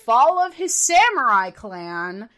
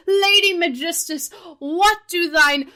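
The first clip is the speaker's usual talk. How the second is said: over-the-top theatrical; 4 semitones higher, 5 dB louder than usual. Retold or imitated. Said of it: "talks like this".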